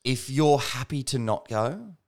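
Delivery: slow, careful, pleading "let's not go". Retold or imitated. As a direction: spell it out slowly, hoping she agrees. Imitating husband's pleading answer.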